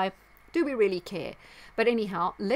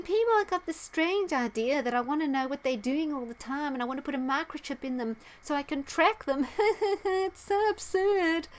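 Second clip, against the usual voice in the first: high, mocking impression with a put-on chuckle; Bill Gates; 7 semitones higher than usual. Vlogger mimicking Gates dismissing microchip rumours, mocking impression. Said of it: "and he was saying".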